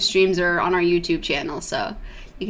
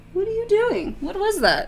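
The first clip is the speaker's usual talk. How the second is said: high-pitched questioning coo; high, soft pet-directed voice; talking to a pet; 12 semitones higher, 3 dB quieter than usual. Real, not acted. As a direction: ask the kitten in a high little voice. Talking to the kitten mid-stream.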